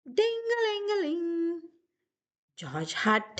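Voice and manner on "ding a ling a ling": high sing-song ringing sound; 1 phone ring. Acted. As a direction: mimic a phone bell, light and high. George rings the telephone.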